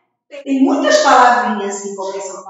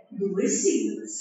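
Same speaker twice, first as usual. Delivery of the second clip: usual speaking voice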